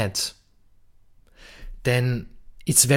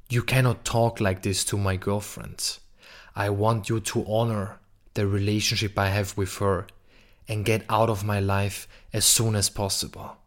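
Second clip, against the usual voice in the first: softer, steady and grounded boundary; calm, rooted firmness; 4 semitones lower, 6 dB quieter than usual. Shown as a demonstration. Telling the man who bothers your girlfriend, softer and firm. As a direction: set the limit from a deep, calm heart. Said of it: "say this from your deepest heart".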